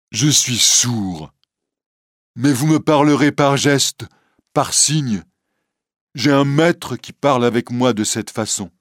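a lightly voiced Quasimodo reassuring Esmeralda; gentle hunchback. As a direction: soothe her like a shy giant. Quasimodo explains he is deaf.

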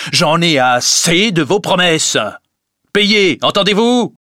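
loud, high, exasperated shout; furious, out of patience; angry demand. A narrator's reading. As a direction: explode at a debtor. Creditor demands payment in quarrel.

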